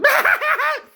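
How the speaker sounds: high, loud laughing fit; nervous involuntary cackle. Acted. Man apologising to a lady, loud nervous cackle. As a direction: burst into an uncontrollable forced cackle. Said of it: "when he's super nervous, he laughs".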